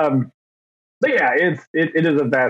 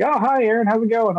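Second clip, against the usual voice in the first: mock friendly greeting; 9 semitones higher, faster than usual. Acting out coworkers' friendly greeting.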